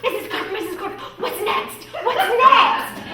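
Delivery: put-on high, excited child's voice building to a yell; pestering little girl. Little girl nagging her teacher after every task, high and insistent. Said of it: "this little girl ... she'd always come up and go".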